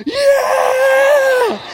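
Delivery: a high, loud whoop of joy; excited shout. Retold or imitated. Proud parent cheering daughter's first soccer goal, shouting. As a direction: yell it like your kid just scored. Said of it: "Kaylee and I were like".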